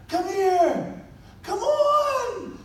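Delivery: very high, loud, beckoning call; excited calling. Retold or imitated. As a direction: wave him in with joy. Father calling son for hug.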